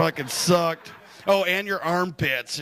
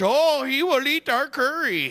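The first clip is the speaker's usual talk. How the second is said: slow, higher put-on Indian accent; a higher, slow put-on Indian accent as the gossiping coworkers; mock Indian accent; 8 semitones higher, slower than usual. Coworkers excluding him from lunch, in a slow higher mock Indian accent. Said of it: "They never invite me to lunch".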